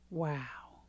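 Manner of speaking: low breathy hushed wow; awed whisper. Acted. Coach marvels at the turnaround, in a low whisper. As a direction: breathe it out in awe. Awed reaction to own example.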